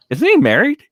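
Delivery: surprised question. Real, not acted. Sudden realisation about Quartering.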